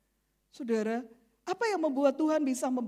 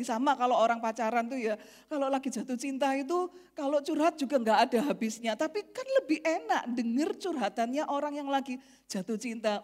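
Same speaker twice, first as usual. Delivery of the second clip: ordinary talk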